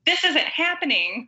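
high-pitched mock disbelief; distraught moviegoer, imitated at normal volume; imitated freak-out. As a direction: mimic a fan losing it in the theater. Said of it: "losing their shit".

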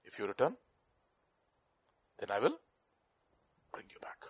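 soft, fading to a whisper on bring you back; soft, fading promise. Acted. God's tender promise to Jeremiah, fading to a whisper.

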